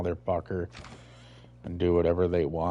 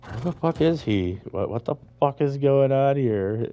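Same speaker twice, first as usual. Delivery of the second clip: higher, mock-bewildered imitation of a lost player; 6 semitones higher, 3 dB louder than usual. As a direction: play a lost newcomer, voice pitched up. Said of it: "it's like".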